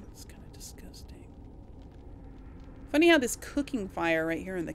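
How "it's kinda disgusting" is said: whispered aside. Real, not acted. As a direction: breathe it out under your breath. Aside about meat in kitchen.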